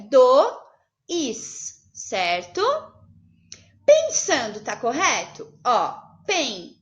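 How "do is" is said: "do, is" said as two separated syllables; wrong split. Shown as a demonstration. Teacher showing a wrong split to the class, syllables spaced. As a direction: break "dois" into do and is.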